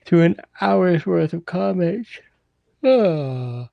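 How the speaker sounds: talking through a yawn. Real, not acted. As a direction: let a yawn stretch the words. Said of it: "I was yawning".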